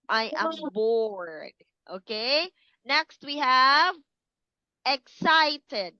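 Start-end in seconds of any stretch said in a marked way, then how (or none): 0.1-1.5 slow, sighing I am bored. Shown as a demonstration. Teacher acting bored again, slow and droopy. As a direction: let it droop with nothing to do. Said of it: "So you are, mm".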